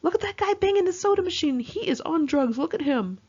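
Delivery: hiss-free loud alarm, like spotting a threat; shrill-free high paranoid exclamation; imitating paranoid friend.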